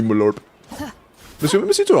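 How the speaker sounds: usual speaking voice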